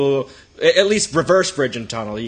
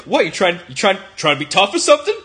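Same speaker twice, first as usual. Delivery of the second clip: mock challenge, rising higher and louder; affected tough-guy voice; 4 semitones higher, 4 dB louder, faster than usual. Drunk man challenging the teller in a bar, affected tough-guy voice. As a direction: put on a drunk guy's confrontational voice. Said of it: "he suddenly affects this voice".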